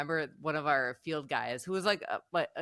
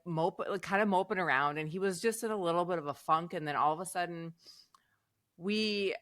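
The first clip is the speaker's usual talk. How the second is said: usual speaking voice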